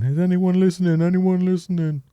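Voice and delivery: lightly hammed-up teacher mimicry; mimicking. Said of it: "does the whole mimicking thing".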